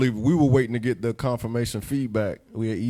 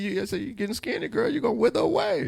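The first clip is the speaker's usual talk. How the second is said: high teasing voice; 10 semitones higher than usual. Retold or imitated.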